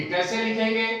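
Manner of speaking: ordinary talk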